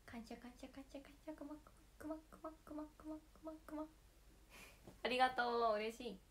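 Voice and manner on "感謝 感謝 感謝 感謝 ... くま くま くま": whispering. Real, not acted. Whispered thanks to fans.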